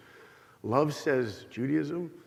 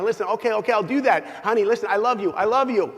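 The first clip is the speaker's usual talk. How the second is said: higher, brushing-off okays to end the call; hurried placating; 6 semitones higher, 4 dB louder than usual. Retold or imitated. Husband rushing to hang up on his wife, hurried placating. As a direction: soothe her fast so you can hang up.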